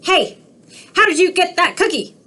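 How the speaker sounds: high, quick cartoon pigeon demanding answers; a nosy, pushy bird; squawky pigeon grilling the duckling. Pigeon voice in read-aloud.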